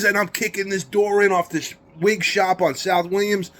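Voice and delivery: ordinary delivery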